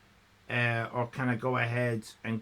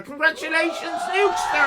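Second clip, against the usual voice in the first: excited cheer; 6 dB louder than usual. Real, not acted. Congratulating a viewer.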